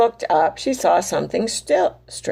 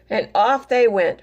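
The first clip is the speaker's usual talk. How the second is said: usual speaking voice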